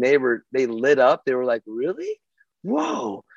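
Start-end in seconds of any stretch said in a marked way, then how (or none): 1.6-3.2 high, soft, wide-eyed "Really? Whoa."; kids amazed. Retold or imitated. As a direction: play the kids lighting up with wonder. Kids react to the news.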